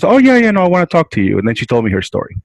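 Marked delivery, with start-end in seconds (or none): 0.0-1.4 imitating an eager patient, much higher on the first words; a bright, quick yes from the patient; patient agreeing to talk, much higher and eager; eager lady. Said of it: "And she goes".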